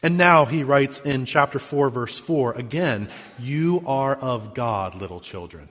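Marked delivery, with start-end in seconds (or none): 3.4-5.7 tender reading. Shown as a demonstration. Quoting the verse tenderly again.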